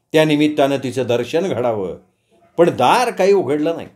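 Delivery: plain reading voice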